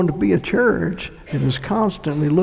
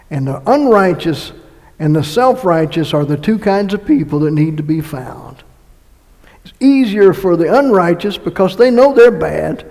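normal talking voice

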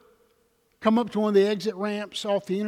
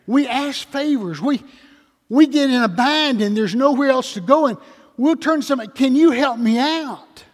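loud, higher-pitched sermon delivery; full-force preaching; 4 semitones higher, 8 dB louder than usual. Real, not acted. Preacher tells congregation we all ask favors, full-force preaching.

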